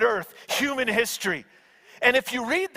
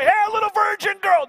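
high, quick mock call; 10 semitones higher, 4 dB louder, faster than usual. Mock version angel didn't say.

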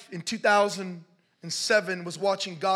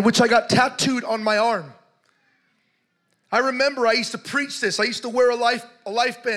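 full-force preaching; 3 semitones higher, 7 dB louder than usual. Real, not acted.

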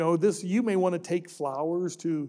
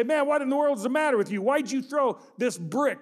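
sharp, fast, higher angry scold, slowing at the end; 6 semitones higher than usual. Retold or imitated. Driver shaking the kid who threw the brick, angry scolding. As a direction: snap at the kid who hit his car.

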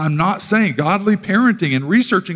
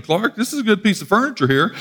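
Uncle Eddie impression; 6 semitones higher than usual. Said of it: "pulled an Uncle Eddie".

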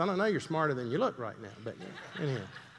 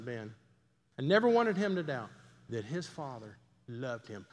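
ordinary talk

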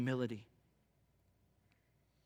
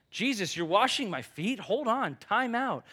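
higher, astonished protest; startled Peter; 6 semitones higher, 4 dB louder than usual. Retold or imitated. Peter halting Jesus at his feet, higher and astonished. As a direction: stop him mid-wash, half laughing.

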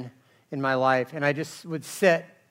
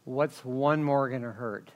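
ordinary talk

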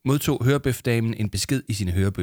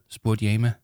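ordinary delivery, nothing marked

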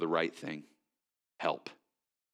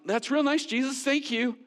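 dismissive thanks; 4 dB louder than usual. Retold or imitated. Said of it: "we're like".

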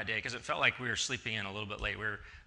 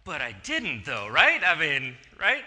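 louder pleading protest; mock indignant; 3 semitones higher, 10 dB louder, slower than usual. Real, not acted. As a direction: appeal to the crowd, mock wronged. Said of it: "comic protest to audience".

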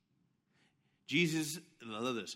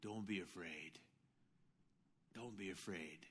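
much softer, low, gentle; tender reassurance; 10 semitones lower, 15 dB quieter than usual. Acted. Jesus calming his frightened disciples, tender and soft. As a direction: soothe them quietly.

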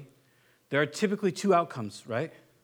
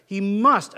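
"must" hit hard and louder; emphatic must; 7 dB louder than usual. Shown as a demonstration. Preacher repeating Paul's emphatic "must", punched. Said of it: "he uses the emphatic".

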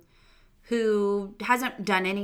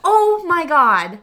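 loud, high, drawn-out disbelief; real astonishment; 9 semitones higher, 13 dB louder, slower than usual. Real, not acted. Podcaster reacting to a surprise mid cast list, astonished. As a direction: stretch it out, loudly amazed.

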